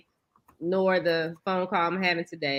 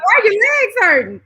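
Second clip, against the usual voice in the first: disbelieving, high and loud question; incredulous mom; 12 semitones higher, 13 dB louder than usual. Retold or imitated. Mom incredulous at her daughter's complaint, high loud disbelief. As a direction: call out the question in mock disbelief. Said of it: "retold mom reaction".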